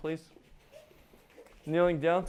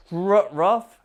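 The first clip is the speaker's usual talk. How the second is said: loud, higher, emphatic burst; rough; 5 semitones higher, 12 dB louder than usual. Shown as a demonstration. Coach contrasting rough with gentle, forceful burst. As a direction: hit the word hard and suddenly. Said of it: "like that really".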